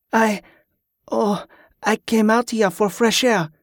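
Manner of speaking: high, halting half-asleep reply; faltering, half-asleep. A narrator's reading. Yvette answers Liam from outside the tent, faltering and half-asleep. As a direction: falter as if barely awake. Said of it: "came the faltering, half-asleep response".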